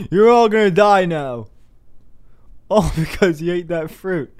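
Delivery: loud, high hammed-up threat, laughing through; God's tantrum, cracking up at the end; mock raging God.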